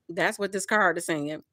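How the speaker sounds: ordinary delivery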